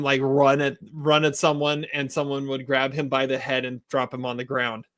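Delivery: ordinary talk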